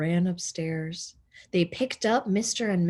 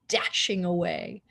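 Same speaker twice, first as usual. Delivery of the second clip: ordinary delivery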